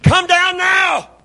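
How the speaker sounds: high, loud, drawn-out command; imitating furious yell. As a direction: bellow it up the pole, stretching each word. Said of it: "he's cussing him back".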